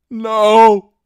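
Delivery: high, loud cry of terror; desperate shout. Acted. Wounded hero to dragon: a high, loud terrified shout. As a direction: yell it as the dragon bites. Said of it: "was all the man had time to shout".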